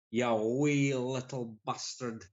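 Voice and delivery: slow Scottish growl on "You wee little bastard"; a slow, half-hearted stab at Scottish; mock Scottish accent. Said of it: "I can't do a Scottish accent".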